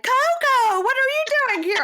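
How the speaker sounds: very high, loud, fast mock shock; fake surprise. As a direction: squeal it like a staged reunion. Mocking their fake surprise at dog.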